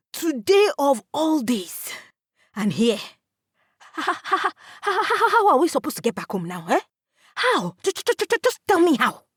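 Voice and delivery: angry brother stuttering demands; upset stuttering. A narrator's reading. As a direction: stammer with rising frustration. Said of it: "He stutters like he does when he's upset".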